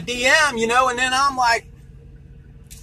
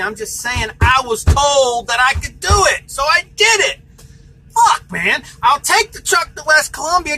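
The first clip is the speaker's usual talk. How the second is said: higher and louder pleading rant; retold angry shout; 6 semitones higher, 7 dB louder than usual. To the caller over the tow, angry shout. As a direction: yell for more time.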